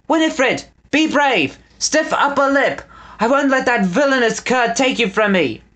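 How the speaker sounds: high heroic calling; rally her with a cry. A narrator's reading. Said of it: "he called".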